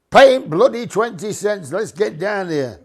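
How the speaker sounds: high, loud impatient yell; crowd shouting. Retold or imitated. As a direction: shout it like a fed-up busload. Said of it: "they're all shouting".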